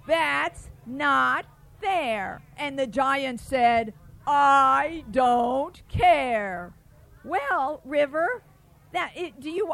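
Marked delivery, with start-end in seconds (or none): 0.1-2.4 slow emphatic complaint; slow drawn-out refrain. Acted. 4.3-6.7 loud, slow giant voice; loud defiant giant. Acted.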